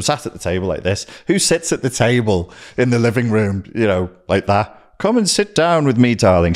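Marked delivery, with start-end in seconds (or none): 5.0-6.5 high-pitched retold beckoning; mock-sweet invitation. Him inviting his partner to the table, high beckoning. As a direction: call a partner over in a raised pitch.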